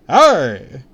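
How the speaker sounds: loud, bright call. Acted. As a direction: shout to catch his attention. Calling out to the man.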